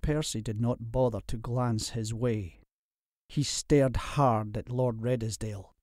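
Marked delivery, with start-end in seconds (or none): none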